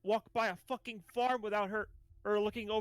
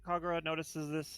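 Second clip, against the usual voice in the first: ordinary delivery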